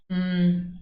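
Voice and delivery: ordinary talk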